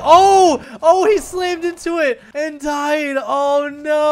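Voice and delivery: loud, high commentator's groan; dismayed outcry. Real, not acted. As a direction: cry out at the crash, drawn out. Reacting to a player's crash.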